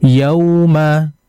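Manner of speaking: ordinary talk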